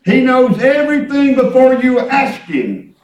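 loud exhortation with stretched words; fervent preaching. Real, not acted. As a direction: drive each phrase home to the congregation. Preaches with full voice.

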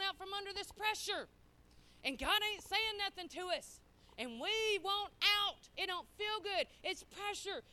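loud, insistent sermon delivery; preaching at full force. Acted. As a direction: drive the point home to the church.

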